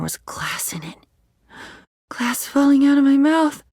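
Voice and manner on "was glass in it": dropping to a whisper; hushed whisper. Acted. Recalling glass in the trail mix, whispered. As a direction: let the horror go breathless and quiet.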